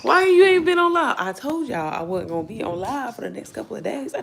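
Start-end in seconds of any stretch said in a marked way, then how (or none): 0.0-1.2 high, loud pushy fan question; mimicking fans. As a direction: demand it like an impatient viewer. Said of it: "Everybody's like".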